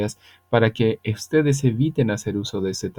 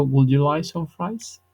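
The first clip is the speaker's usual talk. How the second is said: slow English demo; 5 semitones higher, slower than usual. Shown as a demonstration. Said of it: "entonces digo".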